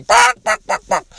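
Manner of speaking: high, loud imitated chicken squawks; sharp mocking "bawk bawk" noises; mock chicken clucking. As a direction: cluck like a cook taunting a coward. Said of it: "all these cooks are going".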